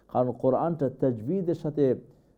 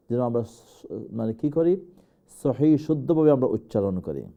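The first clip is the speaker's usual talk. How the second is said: normal talking voice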